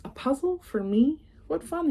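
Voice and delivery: high, delighted little egg; Humpty thrilled with his gift. Acted.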